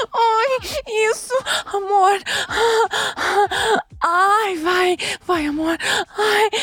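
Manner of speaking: high-pitched pleading with pleasure; moaned urging. Acted. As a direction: urge a lover on between moans. Acts out urging the partner.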